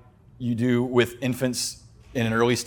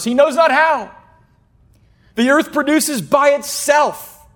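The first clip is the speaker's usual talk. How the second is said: scripture reading; 13 semitones higher, 5 dB louder, slower than usual. Acted.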